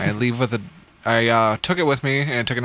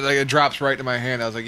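normal talking voice

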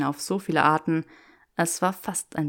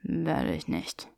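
slow, soft stubborn murmur; murmured refusal; 2 semitones lower, 6 dB quieter, slower than usual. Acted. Draco refusing Granger, murmured softly and slowly. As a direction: mumble it stubbornly. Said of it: "murmelte Draco".

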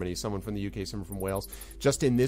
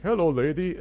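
lightly voiced, higher movie-hero greeting; a hint of a film character, raised and louder; movie impression; 7 semitones higher, 5 dB louder than usual. GM quoting The Princess Bride catch, light impression. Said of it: "You know the end of The Princess Bride".

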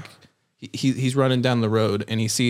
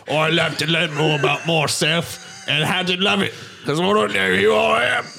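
mock British accent, slow, rising higher at the end; 5 semitones higher than usual. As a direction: put on a posh-actor British accent. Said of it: "says in his different voice".

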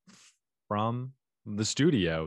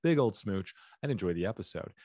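ordinary talk, nothing marked

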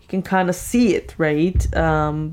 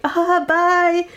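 high, breezy mock goodbye; dismissive brush-off; 11 semitones higher than usual. A woman mocked as she cuts off her date, airy dismissal. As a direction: brush him off airily.

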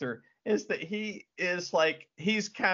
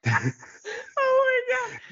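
laughing; 5 dB louder than usual. Real, not acted. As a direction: crack up helplessly on it. Cracking up at silly scene.

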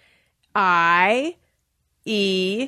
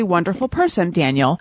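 ordinary talk

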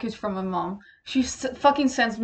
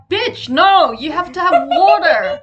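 scolding friend; 7 semitones higher, 7 dB louder, slower than usual. Retold or imitated. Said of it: "I'm just like".

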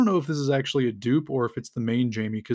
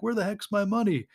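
much higher, shocked outburst; indignant surprise; 8 semitones higher than usual. Retold or imitated. Bald guy discovers his cash is gone, shocked. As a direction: find your wallet empty and cry out.

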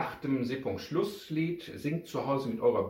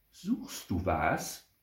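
slow, drawn-out question, lifting at the end; drawn-out offer of help; woman's sudden question; 5 semitones lower, much slower than usual. A narrator's reading. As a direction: ask it slowly, like a helpful stranger. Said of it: "fragte plötzlich eine Frau".

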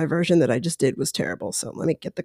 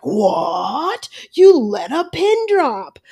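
high, slow mock disbelief; mock shock; 5 semitones higher, much slower than usual. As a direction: pretend the whole house is gasping. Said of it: "the whole house would be like".

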